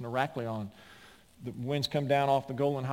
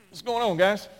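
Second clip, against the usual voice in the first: high, raised questioning voice; acted puzzled query, pitched up; mock innocent waking sleeper; 9 semitones higher, 9 dB louder than usual. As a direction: play someone woken and puzzled. Acts Jesus waking among whiners.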